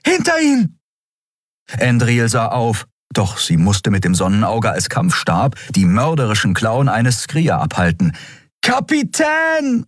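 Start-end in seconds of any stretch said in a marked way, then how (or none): 0.0-0.8 hohe Jungenstimme, hoch und warnend; warnender Ruf. Acted. Junge warnt im Kampf. 8.6-9.8 hoher, gedehnter Ruf; lauter Ruf. Acted. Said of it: "rief Miko".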